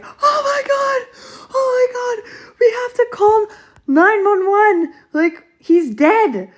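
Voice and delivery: high, crying panic with a sob in the voice; shrieking through tears that he's dead; panicked crying. Retold or imitated. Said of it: "I'm freaking out".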